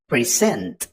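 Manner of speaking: "present" stressed as a verb, pre-SENT; second-syllable stress. Shown as a demonstration. Teacher giving a verb example, "present" stressed pre-SENT. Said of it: "on the second syllable".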